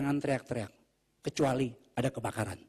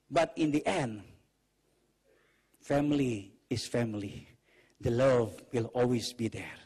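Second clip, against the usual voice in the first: slow, lower, gentle declaration; tender reassurance; 3 semitones lower, slower than usual. Shown as a demonstration. Preacher closing a saying on family love, tender. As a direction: ease down and reassure them slowly. Tender end of quote after shouting.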